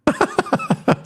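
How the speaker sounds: squeaky burst of laughter; high laugh. Real, not acted. Laughing at joke.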